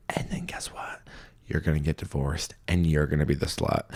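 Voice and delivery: hushed, ominous warning; mock ominous whisper. Podcaster warning a letter writer, in a hushed whisper. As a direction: drop to a whisper for the punchline.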